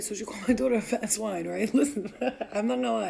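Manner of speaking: usual speaking voice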